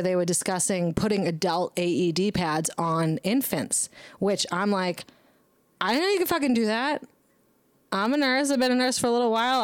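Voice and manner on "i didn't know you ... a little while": high, fast, disbelieving; a nurse thrown by the news, incredulous and fast. Retold or imitated. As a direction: pitch up in disbelief and rush it. Incredulous retold reaction.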